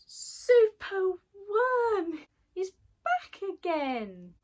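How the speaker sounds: high, loud joyful announcement; triumphant reveal. A narrator's reading. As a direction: proclaim the hero's return. Triumphant return of the hero.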